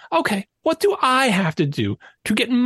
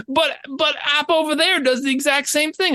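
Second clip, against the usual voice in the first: protesting whine; 7 semitones higher than usual. Retold or imitated. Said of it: "You cannot tell them".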